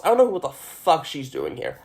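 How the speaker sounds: normal talking voice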